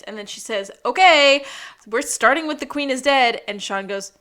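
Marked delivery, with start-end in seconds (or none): 0.8-3.4 a burst of loud enthusiasm; loud eager reply. Retold or imitated. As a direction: jump in loud and keen. Ruby fires back at Sean.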